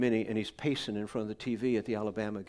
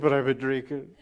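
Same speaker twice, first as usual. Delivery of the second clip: put-on drunk confession; 7 dB louder than usual. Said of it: "He said".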